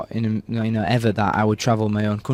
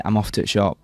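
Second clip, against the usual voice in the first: Yorkshire "off to the shop", "the" cut to a t; Yorkshire dialect; 2 semitones lower than usual. Shown as a demonstration. Yorkshire lad showing his dialect, dropped words in Yorkshire accent. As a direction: clip "to the" into "t'" the Yorkshire way. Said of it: "If you're Yorkshire".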